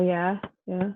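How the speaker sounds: ordinary talk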